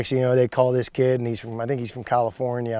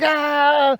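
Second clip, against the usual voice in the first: high, loud fired-up scream; retold war cry; screaming yell; 6 dB louder than usual. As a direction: let out a psyched-up roar. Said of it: "And I'm screaming out".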